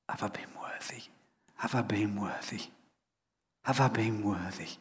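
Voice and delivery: fluisterend, vol tranen, voor zich uit; tearful whisper. Retold or imitated. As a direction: fluister het bij het graf, gebroken. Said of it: "he bursts into tears and says".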